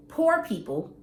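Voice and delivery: normal talking voice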